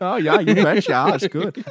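high, loud, slow mock Canadian; Canadian accent. Dad talking to his kid, high Canadian accent. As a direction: stretch out a hammed-up Canadian accent. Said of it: "I just speak in Canadian accents to him".

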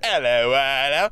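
bad attempt at an Aussie hello; Australian accent. Shown as a demonstration. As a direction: try out an Australian greeting. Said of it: "can you do an Australian accent".

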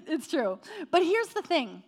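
normal talking voice

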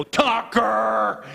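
loud, high shout of the dog's name; retold yell at dog. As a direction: call the dog off sharply. Owner yells at his dog.

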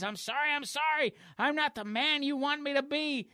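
high, pleading nerd voice; nerdy weakling. Acted. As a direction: stammer it as a cartoon weakling. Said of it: "reverts back to his nerdy ninety-eight pound prepubescent form".

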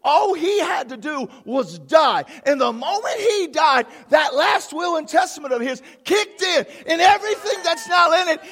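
loud, high, emphatic sermon building to a shout; fired-up pulpit proclamation; full-force preaching. Real, not acted. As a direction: preach it up to a triumphant cry.

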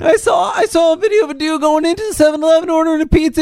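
high, fast Shaggy rambling; high cartoon slacker telling a story. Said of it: "work with the way he actually talks".

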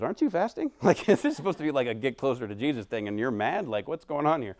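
amused wife poking fun at her angry husband; teasing wife. Retold or imitated. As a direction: tease him like a wife who can't believe it.

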